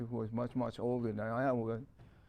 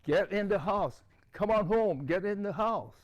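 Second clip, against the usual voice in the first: higher-pitched imitation of a panicked mother calling; mock frantic motherly yell, pitched up; frantic mom yelling; 8 semitones higher, 3 dB louder than usual. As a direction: call the child indoors urgently, high voice. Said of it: "my mom yelling at me frantically".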